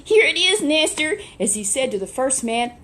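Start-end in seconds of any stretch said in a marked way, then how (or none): 0.0-1.2 much higher and louder, bursting with pride; excited. A narrator's reading. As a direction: present it to the master with glee. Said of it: "they were excited because they had done their job".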